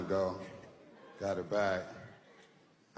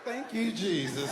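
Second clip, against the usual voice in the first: much higher, shouting praise to Jesus; grateful praise; 10 semitones higher than usual. Retold or imitated. Phone finder praising God for the blessing, joyful shout. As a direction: holler thanks to the heavens like in church. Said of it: "they call it a blessing".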